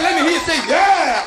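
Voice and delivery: high, loud call-out rising to the "yeah"; crowd call. Shown as a demonstration. Escort pumps up the crowd with a loud "yeah".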